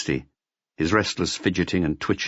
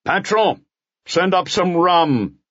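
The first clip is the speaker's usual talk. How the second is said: a raised, slow call for rum; shouting; 11 semitones higher, 3 dB louder, slower than usual. Acted. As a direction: call down the stairs to the landlord. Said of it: "he shouted".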